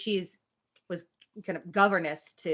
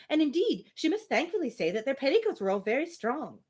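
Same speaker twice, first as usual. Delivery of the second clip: higher, chattering mock voice of a fussy old lady; mimicking Miss Bates; 7 semitones higher than usual. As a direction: lift the voice to parody a prattling spinster. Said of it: "Emma, do not mimic her".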